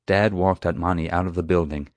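usual speaking voice

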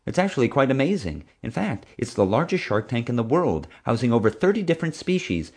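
high, eager recital of facts; helpful, enthusiastic friend. A narrator's reading. Friend praising the shark tank to the bully, enthusiastic. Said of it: "trying to be helpful".

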